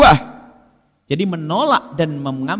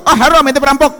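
indignant refusal; 13 semitones higher, 7 dB louder, faster than usual. Retold or imitated. Refusing a thief's gift indignantly.

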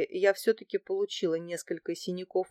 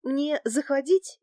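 slow, high, hesitant question; unsure; 6 semitones higher than usual. A narrator's reading. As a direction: ask timidly whether it is allowed. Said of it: "неуверенно спросила я". (неуверенно, медленно, с вопросом)

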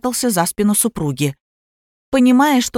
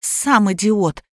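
ordinary delivery, nothing marked

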